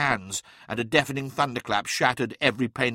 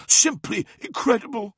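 high, loud amazement from the neophyte; awed character voice, pitched right up; amazed pupil; 12 semitones higher, 5 dB louder than usual. Acted. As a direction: exclaim it high, full of wonder.

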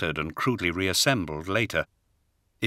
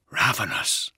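whispered echo; 2 semitones lower, slower than usual. A narrator's reading. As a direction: breathe the word to himself. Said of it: "he echoed".

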